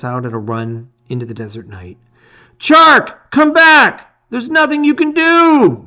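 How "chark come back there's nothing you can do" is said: high, loud desperate shout. A narrator's reading. Hero shouting after Chark racing into the desert, high loud shout. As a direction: yell after a friend who is running off. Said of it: "you shout after him".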